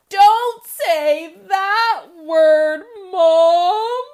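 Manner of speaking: comic refrain shout. Acted. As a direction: land the last punchline big. Michael's final refrain.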